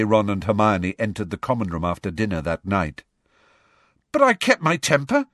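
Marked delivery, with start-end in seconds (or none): 4.1-5.3 horrified. A narrator's reading. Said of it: "said Harry, horrified".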